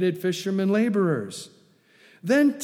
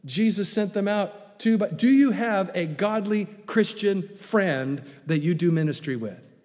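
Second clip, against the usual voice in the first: normal talking voice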